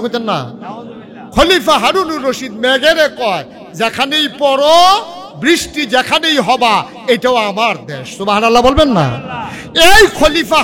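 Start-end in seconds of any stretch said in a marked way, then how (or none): 1.3-8.2 loud, slow, rising preacher fervour; fervent climax. Acted. Preacher delivering the caliph's proud words, loud and slow. 8.2-10.6 loud call for a response; rousing call. Real, not acted. Preacher urging the audience to say Subhanallah, loudly.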